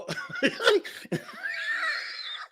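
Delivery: thin, high wheeze of laughter; uncontrollable laughing. Retold or imitated. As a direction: laugh on and on, helplessly. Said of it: "I couldn't stop laughing".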